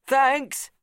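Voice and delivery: loud called-out thanks; shout. A narrator's reading. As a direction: shout it back over your shoulder. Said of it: "he shouted as he left".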